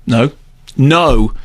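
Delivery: ordinary delivery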